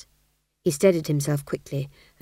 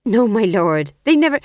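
nervous protest; 4 semitones higher, 8 dB louder than usual. Acted. Fool protests in alarm.

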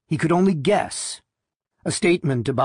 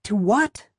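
put-on high, girlish question; little-girl voice; 7 semitones higher than usual. A narrator's reading. Wife asks her husband what to listen to, little-girl voice. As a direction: ask it in a high little girl's voice. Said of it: "her little girl's awed voice".

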